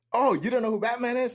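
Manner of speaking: very high, taunting mock question; incredulous drill instructor. Instructor mocks the recruit.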